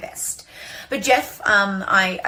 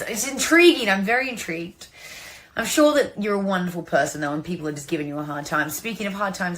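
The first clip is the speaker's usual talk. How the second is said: normal talking voice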